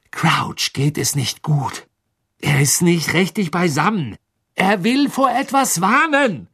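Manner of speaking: slow emphasis rising to a high cry; desperate insistence. Acted. Harry in panic insisting to Snape, increasingly desperate. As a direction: build from pressed explanation to loud plea.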